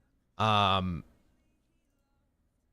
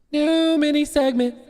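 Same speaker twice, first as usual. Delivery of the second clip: segment announcement; 13 semitones higher, 3 dB louder than usual. Acted.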